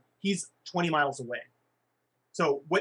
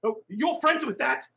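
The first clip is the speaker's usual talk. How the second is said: indignant gnome voice; 7 semitones higher, 4 dB louder than usual. Acted. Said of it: "gnome shocked in character".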